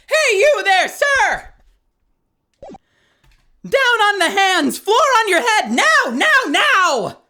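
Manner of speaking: Meekins voice. Acted. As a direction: bark orders like an overeager rookie bailiff. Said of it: "Am I doing the voice right?".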